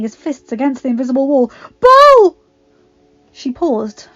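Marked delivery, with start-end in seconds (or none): 1.8-2.4 shout. A narrator's reading. Adam yelling after Belle.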